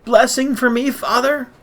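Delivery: very high, anguished begging; Esau's sobbing plea. A narrator's reading. Cheated son begging his father for one blessing, inconsolable. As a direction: implore him, voice climbing in desperation. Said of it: "Esau sobbed inconsolably".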